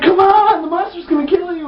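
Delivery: high, loud imitation of a girl; mock urgent shout. Rescuer urges girl out of pit, mock urgent shout. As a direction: plead loudly in hammed-up alarm.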